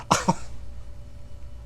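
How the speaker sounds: voiceless, wheezy laugh; quiet burst of helpless laughter; real laughing fit. Real, not acted. Host cracks up at the otter story, laughing. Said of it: "I'm sorry I'm laughing".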